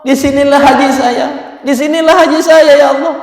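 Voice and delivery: loud, high, anguished cry to God; imitated tearful cry. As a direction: act out crying out to God in tears. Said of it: "Di situ dia menangis". (menirukan seruan tangis kepada Allah)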